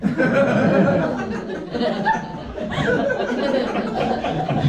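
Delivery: giggling through the words; can't keep a straight face; breaking into laughter. Real, not acted. Comic at the mic cracking up over his own phrase, laughing. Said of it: "Straight face".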